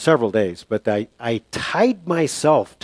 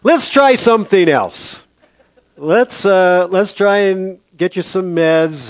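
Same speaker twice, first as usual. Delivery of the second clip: higher, slow, louder coaxing; deliberate, raised-pitch mock coaxing; lightly voiced nurse; 6 semitones higher, 5 dB louder, slower than usual. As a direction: act a nurse slowly talking a patient round. Acts the nurse coaxing him.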